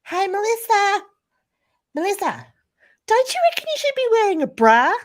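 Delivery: put-on very high, loud old lady call across the schoolyard; nana yelling. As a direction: holler it out like a shameless grandma. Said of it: "she yells out".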